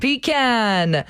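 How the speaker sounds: "Pecan" opening on PEE, ending on CAN; PEE-can pronunciation. Shown as a demonstration. Host agreeing on the tree nut's name, PEE-can pronunciation of "Pecan". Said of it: "debate on how to pronounce".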